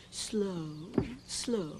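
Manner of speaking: drawn-out low slow, slow; slow counts. Shown as a demonstration. Dance teacher demonstrates the slow step count, drawn out and soft. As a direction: stretch each slow like a dance beat. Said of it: "This is slow".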